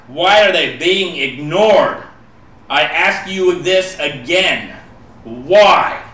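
loud, slow, demanding why. Acted. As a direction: press the question harder, near shouting. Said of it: "I will ask a little louder".

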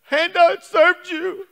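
loud, high, drawn-out acted wail; hammed-up crying plea; mock tearful complaint. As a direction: wail to God like a wronged believer. Voicing a quitter's complaint to God.

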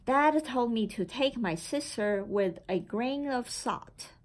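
ordinary delivery, nothing marked

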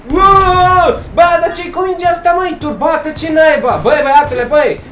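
high startled hoot into agitated complaint; alarmed outcry. Real, not acted. Player complaining about the rabid ball hitting him, high agitated protest.

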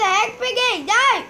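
loud, high "No!"; sudden real dismay; shocked yell. Real, not acted. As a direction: cry out as you lose. Caught by Piggy again.

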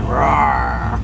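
low, loud playful roar; mock lion roar. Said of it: "Leroy the Lion".

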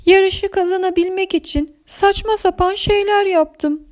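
tiz, üzgün karakter sesi; pişman Zambazilla. Acted. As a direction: incecik sesle mahcupça itiraf et. (pişman, ince sesli itiraf)

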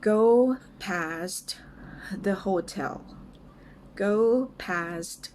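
word-by-word model for repeating, with pauses; slow and clear. Shown as a demonstration. English teacher models a phrase for learners, slow and clear. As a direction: say it slowly, each word apart. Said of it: "ช้าๆ ชัดๆ ไปก่อนนะคะ".

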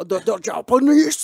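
high, loud, slow put-on Japanese accent; a hammed-up Japanese accent, laughing; mock Japanese accent. Shown as a demonstration. Showing how Japanese people talk, mock Japanese accent. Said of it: "Some Filipinos talk like this".

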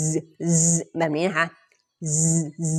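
"z" as a long voiced buzz; buzzed Z sound. Shown as a demonstration. Teacher shows learners how Z sounds, buzzed. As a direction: hold "z" as a buzzing hum. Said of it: "ออกเสียงเป็น".

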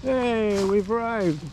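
high, bright excited call; cheerful announcing arrival. Real, not acted. Arriving at the trail spot.

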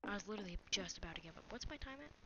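ordinary delivery

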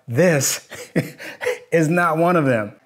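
real laughter breaking into the sentence; laughing hard. Real, not acted. Skeptic cracking up at the thought of Mehdi, laughing hard. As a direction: crack up midway and push on through it. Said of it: "I'm laughing so hard".

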